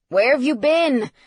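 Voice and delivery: impatient father's reproach; scolding. A narrator's reading. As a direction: demand an explanation. Father scolds late son by phone.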